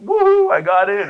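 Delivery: loud, high cheer of triumph; jubilant whoop. Retold or imitated. Student retelling his acceptance, jubilant cheering. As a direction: whoop it out in celebration. Retold celebration on getting accepted.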